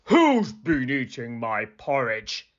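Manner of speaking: gruff Father Bear growl; big gruff bear demanding an answer; great gruff voice. Acted. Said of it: "he said in a great gruff voice".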